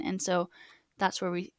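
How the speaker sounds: ordinary delivery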